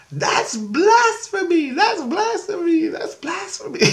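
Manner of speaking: put-on very high squeaky child voice; high-pitched mimicked kid outcry; imitated little girl. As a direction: squeal it like a scandalized three-year-old. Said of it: "the little kid, she runs up to me".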